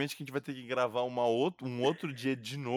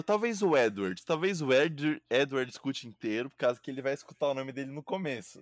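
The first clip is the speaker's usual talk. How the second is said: normal talking voice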